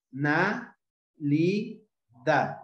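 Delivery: "na, li, da" as detached syllables with pauses between; separated syllables. Shown as a demonstration. Teacher finishing a word's syllables, "na, li, da" separately. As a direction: sound out "na, li, da" one syllable at a time. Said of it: "cuando estamos separando la sílaba".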